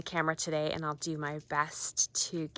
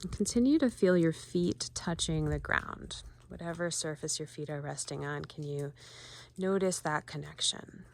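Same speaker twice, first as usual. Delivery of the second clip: guided meditation. Acted. Volunteer guiding viewers to ground themselves, calm meditation voice. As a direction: ease listeners into noticing their feet.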